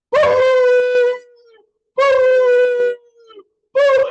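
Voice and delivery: very high, loud drawn-out boos; mock crowd booing. Audience booing as the mother comes out, mock booing. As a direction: imitate a whole audience booing in falsetto.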